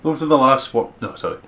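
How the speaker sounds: steady storyteller read; story narration. Acted. Narrating the walk back to school, even storyteller tone. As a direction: carry the listener through the scene. Reads visual novel narration.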